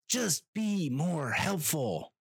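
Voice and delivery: high, slow, drawn-out pleading; slow exasperated whine at the airline; exasperated plea. Acted. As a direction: stretch each word out in high-pitched frustration.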